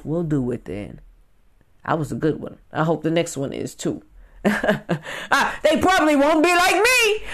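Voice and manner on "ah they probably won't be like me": higher laughing boast. Real, not acted. Vlogger bragging about being irreplaceable, laughing. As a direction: laugh through the brag.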